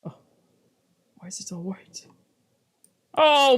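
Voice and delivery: ordinary talk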